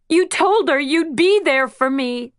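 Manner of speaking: put-on girl blaming her godmother; tearful accusation. A narrator's reading. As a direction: accuse her through tears.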